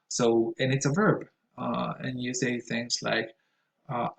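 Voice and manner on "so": ordinary talk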